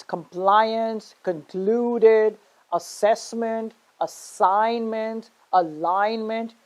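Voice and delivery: slow compliance, concluded, assessment, assignment, alignment, each second syllable stretched; stress on second syllable. Shown as a demonstration. Teacher drilling the chart, second syllables of compliance, concluded, assessment, assignment, alignment held long. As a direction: draw out PLI, CLU, SESS, SIGN, LIGN in compliance, concluded, assessment, assignment, alignment. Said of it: "You see, I'm stressing".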